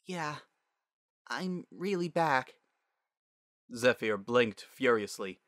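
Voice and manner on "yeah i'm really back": high, slow, tender voice; tender daughter. Acted. Returned daughter to her father, tender. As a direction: gently confirm it, pitched up.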